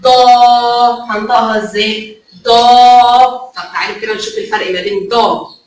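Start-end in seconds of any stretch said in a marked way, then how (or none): 0.0-1.1 ضا with the aa held long and steady; loud, drawn-out ضاااا; long alif vowel. Shown as a demonstration. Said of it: "مد بالالف". 2.4-3.4 ضا held loud and long on a level note; sustained, loud ضاااا; long alif vowel. Shown as a demonstration. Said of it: "مد بالالف".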